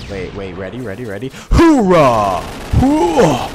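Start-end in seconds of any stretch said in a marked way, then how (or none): 1.5-3.5 loud, drawn-out whoop; battle cry. Real, not acted. Gunner firing from the bunker, loud battle cry. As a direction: bellow a war cry.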